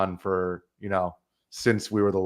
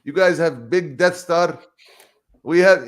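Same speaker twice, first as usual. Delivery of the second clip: put-on higher, louder voice with a foreign accent; imitating Omar's accent; 6 semitones higher, 6 dB louder than usual. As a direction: mimic the DVD seller's broken English, lightly raised. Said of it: "He's like".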